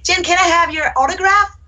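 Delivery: put-on a thirteen-year-old begging sweetly; teenage student asking her teacher for an autograph; teen fan. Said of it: "go like".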